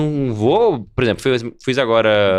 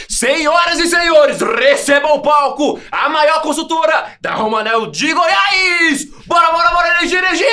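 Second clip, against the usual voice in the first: high, slow, booming MC announcer shout; grand entrance announcement; 5 dB louder, slower than usual. Shown as a demonstration. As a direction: hype the crowd like a stage host. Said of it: "Então eu vou começar".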